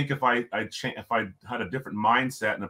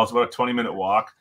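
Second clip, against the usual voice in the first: ordinary delivery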